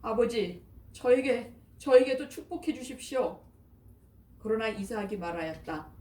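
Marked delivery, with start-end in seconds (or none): 0.0-3.4 higher, louder pleading wail of a wronged son; anguished crying plea. A narrator's reading. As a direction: beg through grief, voice rising. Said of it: "비통에 차 큰 소리로 울부짖으며". (비통하게 울부짖는 목소리)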